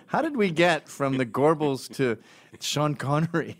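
ordinary talk, nothing marked

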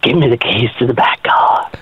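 low whispered gravelly command; Batman voice. Acted. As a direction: whisper it like a masked vigilante. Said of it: "can you give us another line?".

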